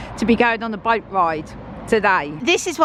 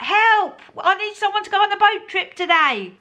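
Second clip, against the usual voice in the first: high, panicky plea; mock panic; 10 semitones higher than usual. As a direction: squeal it like a panicked cry for help.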